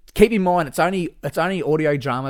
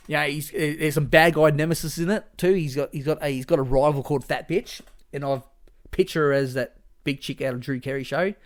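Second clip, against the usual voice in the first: normal talking voice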